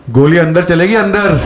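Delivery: loud gruff threat from the dark; menacing heckler. Retold or imitated. Heckler in the dark warning the comic, loud and menacing. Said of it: "किसी की आवाज़ आई".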